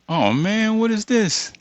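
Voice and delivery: ordinary delivery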